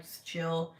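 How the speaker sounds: ordinary talk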